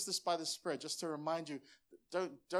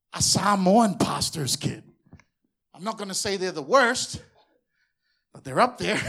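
loud punchline; 3 semitones higher, 16 dB louder than usual. Real, not acted. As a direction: belt it out to the congregation. Preacher shouting punchline to congregation.

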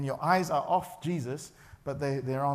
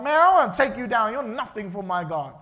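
bold declaration; 4 semitones higher, 8 dB louder than usual. Acted.

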